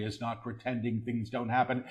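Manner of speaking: quick, soft undertone; hushed preview. Real, not acted. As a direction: say it softly and rapidly before proclaiming it. Soft take before repeating aloud.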